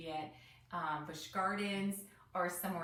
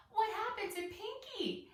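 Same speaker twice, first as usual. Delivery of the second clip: high worried question; 13 semitones higher than usual. A narrator's reading. Child Ike asks about the lost flamingo, high worried question. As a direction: ask it high and anxious.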